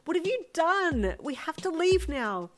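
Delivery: slow, high, loud dismay; hollered alarm. A narrator's reading. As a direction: drive home the danger. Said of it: "you hollered".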